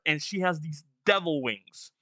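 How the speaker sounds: ordinary talk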